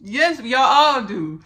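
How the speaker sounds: loud, high, slow exclamation; emphatic assurance. Retold or imitated. Telling listeners they all have a teacher, emphatic assurance. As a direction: call it out big, stretching the words. Turns ancestor's correction on listeners.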